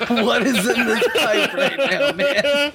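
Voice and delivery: put-on slow, laughing New York worker accent; amazed New York worker, laughing through it. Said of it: "New York accents".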